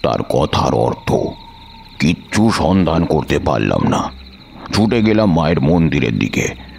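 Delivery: plain reading voice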